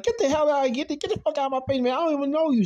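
high, fast, heated outburst; rapid high-pitched telling-off; angry rejection. Retold or imitated. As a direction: act out a furious son. Voices Sean angrily rejecting father.

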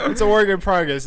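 said through a laugh. Real, not acted. Laughing over jingle.

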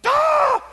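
high sharp cry of exasperation; mock exasperated cry. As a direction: let out a sudden high yelp. Preacher's cry while retelling.

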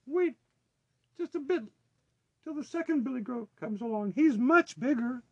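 very high little goat, bargaining; squeaky falsetto plea to wait; small voice. A narrator's reading. Littlest goat bargaining with the troll, tiny high voice. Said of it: "with such a small voice".

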